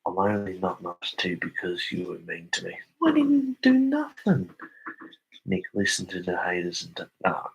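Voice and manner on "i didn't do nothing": put-on high squeaky childish protest; squeaky mock-innocent voice; mock whiny denial. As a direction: whine it like a caught kid.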